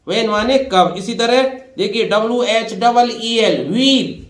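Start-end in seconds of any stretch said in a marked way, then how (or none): 3.7-4.3 व्हील with the h wrongly sounded, higher; high-pitched व्हील, h not silent; wrong pronunciation. Shown as a demonstration. Teacher showing the common mistake in व्हील, h sounded. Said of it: "ये व्हील नहीं है".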